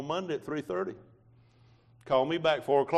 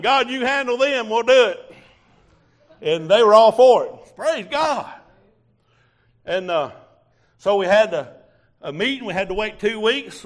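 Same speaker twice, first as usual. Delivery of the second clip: loud, high testimony from the pulpit; preaching at full force; 5 semitones higher, 9 dB louder than usual. Real, not acted. Preacher testifying to his church, loud and fervent. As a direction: preach it out to the congregation.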